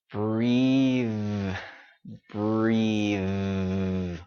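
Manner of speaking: "breathe" stretched long with a buzzing voiced TH; voiced ending. Shown as a demonstration. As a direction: hold the voice through the end of "breathe". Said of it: "Keep that voice going".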